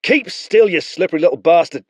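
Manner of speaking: high, loud, fast snarl; snarling spearman. Acted. Spearman snarls at the wriggling boy, loud. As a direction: bite the words, annoyed. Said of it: "the spearman snarled".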